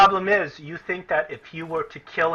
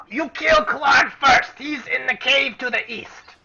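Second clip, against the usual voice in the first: very high, slow shouted goblin demand; 10 semitones higher, 4 dB louder, slower than usual. Acted. Goblin refuses the party's demand, shrieking. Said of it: "he says".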